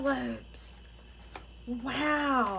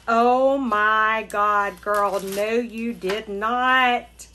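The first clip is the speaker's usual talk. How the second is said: louder, slow and drawn-out disbelief; overwhelmed delight; 6 dB louder, slower than usual. Real, not acted. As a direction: stretch it out in happy disbelief. Overwhelmed by the gifts.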